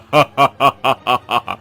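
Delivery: low villainous cackle; gloating monster laughter, pitched low; evil ghost laugh. Acted. (भूत का शैतानी ठहाका)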